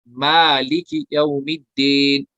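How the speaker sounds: मालिक यौमिद्दीन recited slowly and drawn out; slow recitation. Shown as a demonstration. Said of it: "ये मैं सिखा रहा हूँ".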